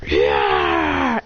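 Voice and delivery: long drawn-out "yeah" battle yell; battle cry. Acted. Pokemon going into battle, long battle cry. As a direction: let out a long charging cry.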